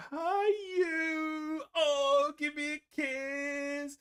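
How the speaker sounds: put-on high, slow doting old nan. Grandson visiting, nan greets him in a high doting voice. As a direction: coo it like a fond grandmother greeting her grandson. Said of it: "And she'll go".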